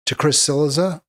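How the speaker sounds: ordinary talk, nothing marked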